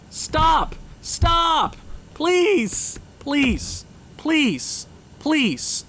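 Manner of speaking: high, strained begging; desperate pleading. Real, not acted. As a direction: beg the game to stop. Begging the sim team to stop losing.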